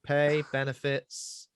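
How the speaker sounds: slow, deliberate listing. Pretend. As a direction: draw out each perk. Listing job perks slowly.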